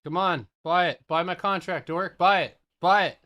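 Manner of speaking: loud, high excited shouting at the chart; hyped trader screaming at the screen; excited yelling. Real, not acted. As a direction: yell at the trade to go through. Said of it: "I get a little bit excited sometimes".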